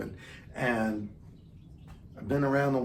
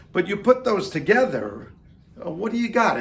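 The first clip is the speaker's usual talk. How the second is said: mock outrage; 7 semitones higher, 5 dB louder than usual. Real, not acted. Mock outrage at pizza bagel.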